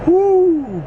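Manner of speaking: high whooping cheer of glee; thrilled little whoop; excited whoo. Real, not acted. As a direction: let out a delighted whoo. Excited about fitting more boxes.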